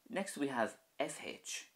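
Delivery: ordinary talk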